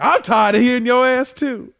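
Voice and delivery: loud, high mock complaint; fed-up cat. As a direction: scold as an exasperated cat. Cat scolding the other cat.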